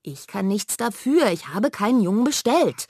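sister stamping her foot in protest; angry protest. Acted. Laut und trotzig, gedehnt. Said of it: "Manja stampft mit dem Fuß auf".

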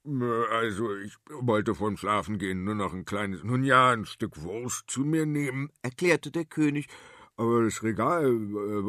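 lightly voiced embarrassed king; a hint of a sheepish king; sheepish old king. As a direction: mumble excuses like a caught king. King makes excuses, embarrassed.

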